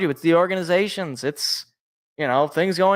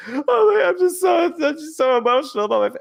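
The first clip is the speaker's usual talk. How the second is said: high, hammed-up mock choke-up; joking about tearing up, voice pitched way up; mock tearful; 10 semitones higher than usual. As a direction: fake getting emotional about family. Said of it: "I'm not crying".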